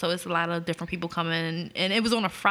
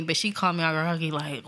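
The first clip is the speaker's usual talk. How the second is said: ordinary talk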